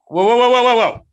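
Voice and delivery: loud, high run of whoas; urgent interjection. Real, not acted. Co-host halts the accent-match claim, loud objection. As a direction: cut in loudly to halt the other speaker.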